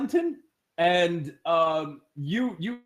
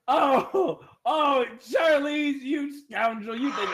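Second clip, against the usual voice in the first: higher, slow, hammed-up indignation; mock-offended guard; 8 semitones higher, much slower than usual. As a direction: ham up the scolding. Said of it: "He goes".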